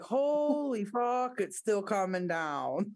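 slow, drawn-out disbelief; retold amazement. Retelling the snow that kept falling, amazed. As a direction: start high and stretch it out in awe.

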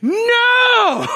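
very high, loud comic scream; unmasculine panicked shriek. Acted. Preacher re-enacting his response to a snake, high panicked shriek. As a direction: shriek it high, hamming up the terror. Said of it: "this was my response".

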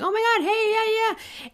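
high-pitched delighted reunion greeting; excited greeting. Retold or imitated. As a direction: squeal hello to a long-lost acquaintance. Greeting someone not seen for years.

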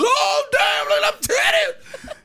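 very high, loud, laughing mock shout; idiot ogler. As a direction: ham up a crude gawker. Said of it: "the idiot that goes".

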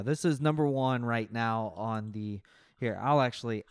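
ordinary delivery

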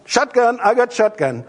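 high, loud playground call; kid calling shotgun. Retold or imitated. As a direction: shout it like a kid grabbing the front seat. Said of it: "they're gonna be going".

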